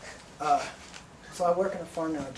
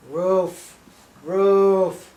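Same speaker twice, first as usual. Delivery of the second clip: put-on baby demanding; 3 semitones higher, 11 dB louder, much slower than usual.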